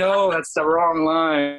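high, drawn-out mock scolding; imitating a crew member correcting an actor; exasperated correction. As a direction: call out the mistake slowly, hammed-up. Crew correcting his missed line, hammed up.

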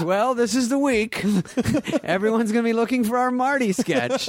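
a straight-faced boss blessing a bad idea, through giggles; Lorne's dry verdict. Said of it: "Lorne goes".

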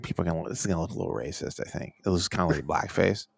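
mock laid-back bro, low and quiet; a hint of a stoned bro. Bro voicing Scott Disick's friend.

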